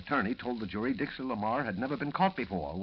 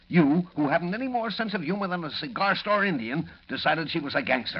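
angry sarcasm; 5 semitones higher, 5 dB louder than usual. Acted. Editor mocking reporter.

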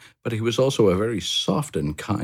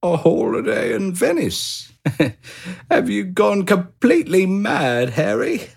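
much higher, incredulous laughing hamster; Pip laughing in disbelief; 14 semitones higher than usual. A narrator's reading. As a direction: laugh off the crazy idea.

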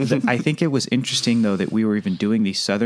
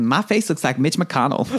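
higher, put-on Southern voice; Southern accent; 4 semitones higher than usual. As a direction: joke along in a Southern accent. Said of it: "read it in Southern accents".

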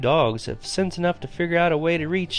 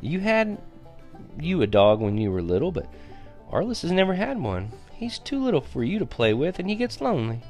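higher-pitched, softened mother's voice; gentle chiding; 7 semitones higher, 5 dB quieter than usual. A narrator's reading. Mama pleading little brother's case to Travis, gently chiding.

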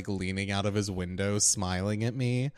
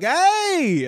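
loud high hey; calls out loud and high like the stranger; mock call; 10 dB louder than usual. Imitating the man waving from his car, shouting. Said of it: "he's like".

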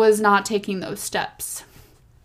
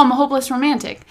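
ordinary talk, nothing marked

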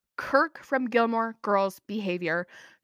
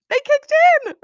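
much higher and louder, bursting with joy; thrilled squeal over her mama instincts; excited squeal; 13 dB louder, faster than usual. Real, not acted. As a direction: shriek it like big news.

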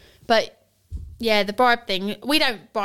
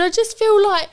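imitated child's upset, high and louder; retold crying child; 9 semitones higher, 4 dB louder than usual. Son explaining feelings to parent, imitated high tearful wail. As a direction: wail it like an overwhelmed kid. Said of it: "like, crying his eyes out".

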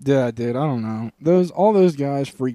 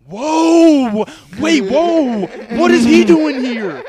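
much higher and louder, stunned at the gates; shocked guy; 12 semitones higher, 7 dB louder, much slower than usual. Retold or imitated. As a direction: play it wide-eyed, high and loud. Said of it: "the guy goes".

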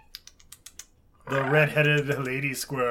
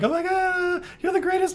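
gushing squeaky voice; 4 dB louder than usual. Retold or imitated. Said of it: "she's like".

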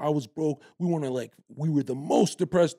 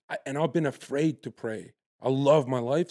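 ordinary talk, nothing marked

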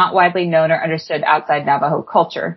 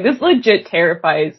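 ordinary talk, nothing marked

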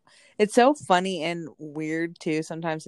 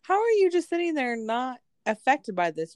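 mock-outraged high protest; acted incredulity; 9 semitones higher than usual. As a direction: demand it in a soaring, baffled voice. Retells confronting unmoved husband.

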